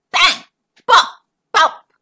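punchy bang and bop sounds; playful sound effects; crash noises. Acted. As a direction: voice the bumps loudly.

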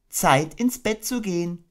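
high, gentle mother kangaroo voice; motherly Kanga. Acted. Kanga tucking her baby into bed, motherly. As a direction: coo it like a doting mother.

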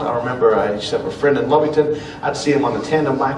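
normal talking voice

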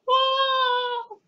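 very high, drawn-out "whoa"; mock falsetto yelp of shock; acted shocked reaction. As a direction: let out a long high whoa. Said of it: "I'm gonna be like".

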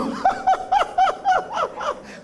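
a burst of high laughter; high giggle. Real, not acted. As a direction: laugh out at the image. Joke about laughing baby. (ketawa kecil nyaring)

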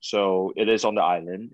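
ordinary talk, nothing marked

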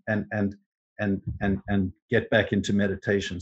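normal talking voice

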